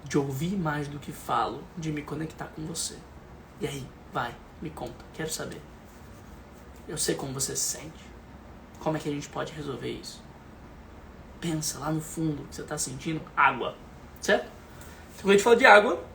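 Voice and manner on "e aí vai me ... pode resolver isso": much softer, gentle invitation; calm, soft coaxing. Shown as a demonstration. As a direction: coax the child tenderly. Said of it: "intenção de tá calmo". (fala bem baixinha e carinhosa)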